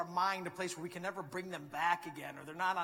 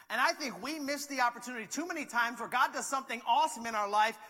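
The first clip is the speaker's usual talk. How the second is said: louder, raised preaching voice; impassioned sermon delivery; full-force preaching; 5 semitones higher, 8 dB louder than usual. Acted. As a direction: preach it out to the room.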